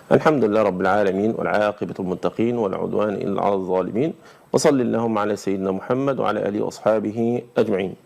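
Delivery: debate host opening with a religious formula, solemn; formal opening invocation. Acted.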